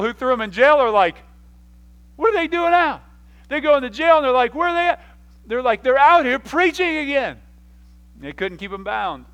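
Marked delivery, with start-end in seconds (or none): 2.1-3.0 high, loud mock outcry; astonished jailers. Acting out baffled jailers. 4.5-5.0 loud, high-pitched mock alarm; baffled jailers. Acting out jailers finding nobody. 5.8-7.4 high, loud mock outrage; incredulous captors. Acting out exasperated jailers.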